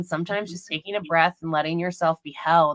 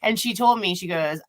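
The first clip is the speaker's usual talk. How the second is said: ordinary delivery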